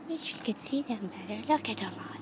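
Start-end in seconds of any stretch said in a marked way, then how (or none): none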